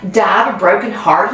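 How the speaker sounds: usual speaking voice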